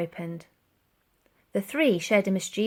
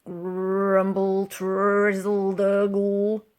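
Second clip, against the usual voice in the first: belly rumbling noises; 4 dB louder, much slower than usual. A narrator's reading.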